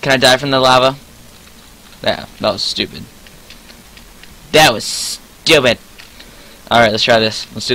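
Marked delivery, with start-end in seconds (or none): 2.0-3.0 muttered self-criticism. Real, not acted. Low take, then drawn-out repeat. 4.5-5.8 slow, drawn-out STUPID; "stupid" held long and slow; exasperated. Real, not acted.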